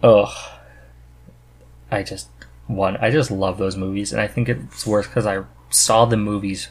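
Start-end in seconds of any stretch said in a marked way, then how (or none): none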